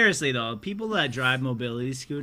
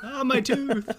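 mock pain; 11 semitones higher, 4 dB louder, slower than usual. Mock injured boyfriend.

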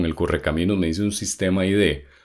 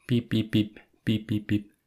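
higher, soft, even electronic beeps; phone beep imitation; 4 semitones higher, 5 dB quieter, slower than usual. Showing how the lost handset sounded, imitated beeping. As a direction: beep like a cordless phone locator. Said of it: "empezó a sonar".

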